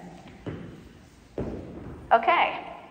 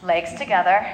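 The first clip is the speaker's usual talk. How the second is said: ordinary delivery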